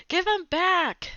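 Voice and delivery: high, slow cry of give it back; high pleading protest; pleading demand. Acted. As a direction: beg to have him back. Rob demands his toy back.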